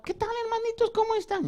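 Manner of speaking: a humble man saying hello with no grandeur; Paul's plain greeting. Retold or imitated. Preacher imagining Paul greeting the church, high and unimpressive. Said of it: "el apóstol Pablo llegaba".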